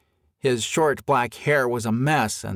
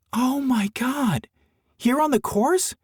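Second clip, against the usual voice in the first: shocked; 13 semitones higher than usual. Acted. Amy shocked by the attack.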